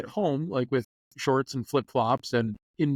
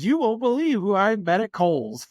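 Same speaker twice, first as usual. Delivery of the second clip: 1 excited gossip; 8 semitones higher, 4 dB louder than usual. Retold or imitated. Husband told of meeting.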